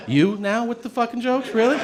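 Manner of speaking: high, incredulous complaint; mock exasperation. Patient to joking doctor, high mock exasperation. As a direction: throw it back high, in disbelief.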